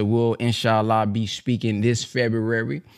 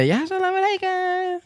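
mocking the crowd's greeting. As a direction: parody the believers' eager greeting. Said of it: "y'all know how y'all be".

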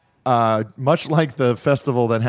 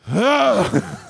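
relieved groan; 6 semitones higher than usual. Retold or imitated. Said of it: "we hear you go".

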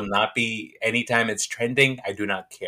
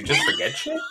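high laughing yell of teasing disbelief; teasing a forgetful friend, laughing; laughing shout; 3 dB quieter than usual. Real, not acted. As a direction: shriek it out through laughter.

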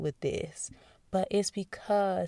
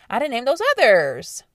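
pointed aside; 6 semitones higher, 10 dB louder than usual. Real, not acted. Pointed aside about naming.

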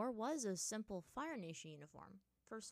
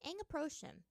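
plain reading voice

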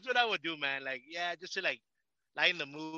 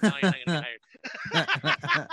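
slow, laughing put-down; slow laughing teasing wife; 2 semitones higher, 3 dB louder, slower than usual. Retold or imitated. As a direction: tease him through a laugh. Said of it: "my wife's like".

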